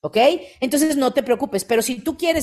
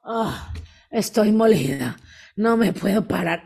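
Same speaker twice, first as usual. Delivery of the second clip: mock exhausted groan. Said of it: "si yo me despierto y digo".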